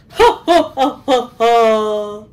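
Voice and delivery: loud, open laughter; hearty laugh. A narrator's reading. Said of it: "he laughed out loud".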